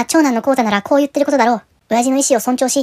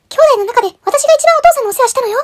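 high greedy sister voice, insistent; shrill sister demanding; 13 semitones higher, 4 dB louder than usual. Acted. Sister arguing with brothers, high demanding voice. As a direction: play the sister claiming the bigger share. Sister claims she cared most.

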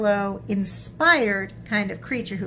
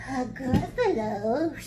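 slow, questioning snake voice; puzzled snake; 4 semitones higher than usual. Acted. Snake asking mouse about an unknown beast, slow and puzzled.

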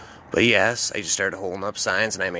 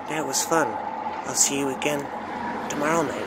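higher voice of the tiny woman; higher sing-song female voice; woman's voice; 6 semitones higher than usual. Retold or imitated. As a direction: pitch it up, sweet and light. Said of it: "And she goes".

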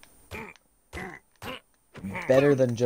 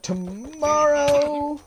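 slow, very high squeal; high squeaky vow; 13 semitones higher, much slower than usual. Pretend. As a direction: squeak it out defiantly. Defiant vow, voice shoots up.

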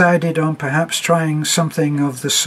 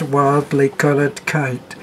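plain reading voice

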